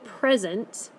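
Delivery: "present" said PREZ-ent; stress on first syllable. Shown as a demonstration. Teacher showing the word at the top, PREZ-ent. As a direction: noun form "present", PREZ-unt, weight up front. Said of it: "dark part of the word is at the front".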